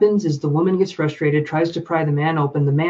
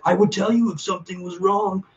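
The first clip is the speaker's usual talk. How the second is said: imitating a man; 3 semitones higher than usual. Female viewer's boyfriend denying anything is wrong, lightly mimicked. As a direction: put on a mild man's voice brushing her off. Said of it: "I have to pretend to be a man".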